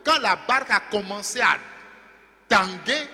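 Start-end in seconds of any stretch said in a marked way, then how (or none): none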